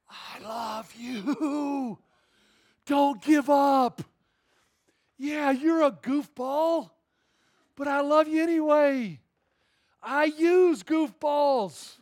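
high, slow, loving call; drawn out and lovingly to each listener; loving God's voice. Retold or imitated. God calling out love to the congregation. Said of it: "You'd hear".

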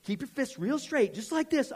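imitating his own rising anger; getting angry. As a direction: urge him on like a riled-up dad. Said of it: "I'm getting angry. I'm like".

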